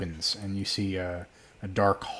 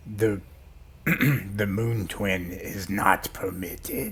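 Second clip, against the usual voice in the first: slow, solemn gatekeeper voice; stern door guardian; much slower than usual. Acted. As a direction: intone it like an ancient door. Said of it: "The door says".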